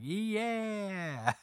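high, drawn-out mock cheer; crowd cheer. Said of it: "everybody's like".

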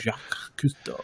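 put-on Jacques Cousteau style French accent; slow soft French-accented name. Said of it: "you do that pretty good".

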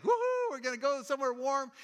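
high whooping cheer. Retold or imitated. Family cheering as they head south, high and gleeful. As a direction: whoop it up like kids on a trip. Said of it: "and they're like".

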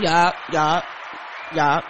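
loud, punchy victory yups; loud triumphant yup. Real, not acted. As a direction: crow it out, word by word. Celebrates her own hang-up triumph.

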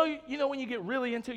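normal talking voice

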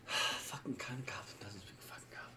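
sighing grumble under the breath; whispered exasperated grumble; muttered whisper. Acted. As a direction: mutter it to yourself in disbelief. Grumbling about goblin not speaking Goblin.

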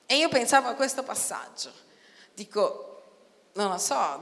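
ordinary delivery

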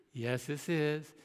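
guarded and courteous, drawn out; careful polite answer. Retold or imitated. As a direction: answer the caller with measured politeness. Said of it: "You gotta watch out how you".

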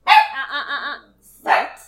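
sharp loud uh-uh then sit; loud correction. Real, not acted. Owner sharply correcting her dog to sit. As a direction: stop the dog with a loud warning.

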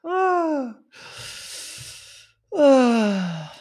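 long falling "ah" winding down from laughter; real laughed-out sigh; high sigh after laughing. Real, not acted. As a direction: let out a high, drawn sigh to recover. Sighing after laughing fit.

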